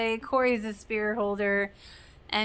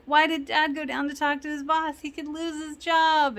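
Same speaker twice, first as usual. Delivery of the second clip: higher-pitched imitation of a panicking kid; mock alarm, pitched up throughout; freaked out; 6 semitones higher than usual. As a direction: retell it in a worried, raised voice. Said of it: "Corey's now freaked out".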